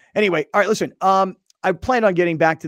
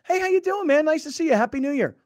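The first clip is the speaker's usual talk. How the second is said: phony cheerful greeting; 11 semitones higher than usual. Shown as a demonstration. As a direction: greet them like you mean it, but you don't. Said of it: "Like, just be phony".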